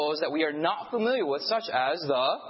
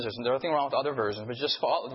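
ordinary delivery

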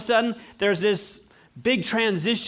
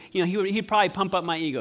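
ordinary talk, nothing marked

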